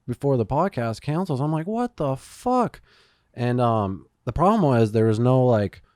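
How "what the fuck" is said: high, drawn-out incredulous cry; stunned disbelief. Retold or imitated. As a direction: act out the shock of being stood up. Retold outrage at late cancellation.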